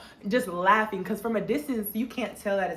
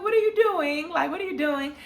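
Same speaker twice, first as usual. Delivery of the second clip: giddy question; 8 semitones higher than usual. Retold or imitated. Regina giddy talking to Cam.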